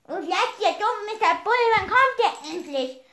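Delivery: mocking call out to the enemy; loud taunt. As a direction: yell the taunt with a laugh. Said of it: "ich frag diesen Gegner auch laut aus".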